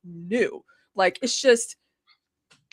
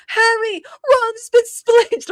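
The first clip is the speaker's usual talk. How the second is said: high, slow, louder mock-Hermione line; playful Emma Watson imitation, much higher and slow; Emma Watson impression; 12 semitones higher, 7 dB louder, much slower than usual. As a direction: copy Emma Watson's Hermione, dragging each word out. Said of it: "she sounded exactly like Emma Watson".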